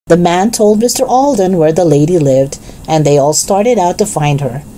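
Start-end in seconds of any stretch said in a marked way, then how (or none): none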